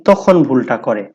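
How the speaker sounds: usual speaking voice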